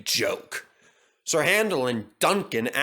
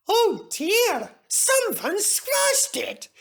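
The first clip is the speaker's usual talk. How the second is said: much higher, slow little engine voice, dismayed; a small engine sadly noticing the damage; Peter Sam dismayed; much slower than usual. A narrator's reading. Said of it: "said Peter Sam".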